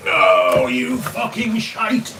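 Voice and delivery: sudden angry cry of pain, slowed; pained shout. Real, not acted. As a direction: yell as you hit your foot. Stubs foot on drawer.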